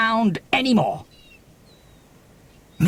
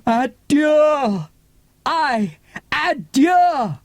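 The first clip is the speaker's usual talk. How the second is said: crying adieu; 5 semitones higher, much slower than usual. Acted.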